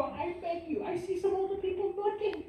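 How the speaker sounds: ordinary delivery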